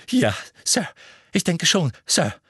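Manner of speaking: lightly voiced eager clerk. A narrator's reading. Clerk eagerly assures the minister. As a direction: answer dutifully, keen to please. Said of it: "sagte Percy beflissen".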